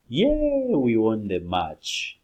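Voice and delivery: cheering a win; happy cheer. Shown as a demonstration. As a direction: demo of happy celebration.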